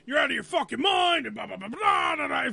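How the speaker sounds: retelling a boss yelling, half-shouted; hammed-up retold rant; mock yell. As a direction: replay the boss flipping out at you. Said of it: "Schwenker yelling at me".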